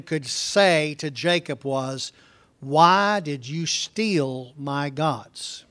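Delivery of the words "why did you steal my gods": very slow, drawn-out question; slow accusation. Acted. Preacher reading Laban's accusation, very slow. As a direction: drag out each word of the accusation.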